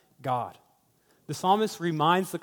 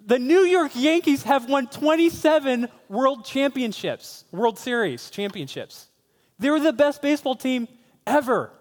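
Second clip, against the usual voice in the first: worked-up fan, much higher and louder; [name]; 9 semitones higher, 6 dB louder than usual. Acted. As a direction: passionately defend your team. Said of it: "he's getting a little emotional".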